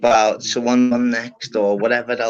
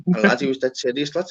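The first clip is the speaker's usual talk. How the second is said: ordinary talk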